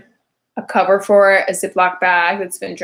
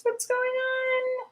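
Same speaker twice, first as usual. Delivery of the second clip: squeaky panicked question; 9 dB quieter, much slower than usual. Retold or imitated. Panicked future mom quoted.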